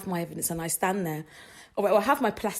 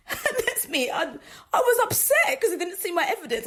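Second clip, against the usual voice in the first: laughing; 10 semitones higher than usual. Real, not acted. Laughing at herself.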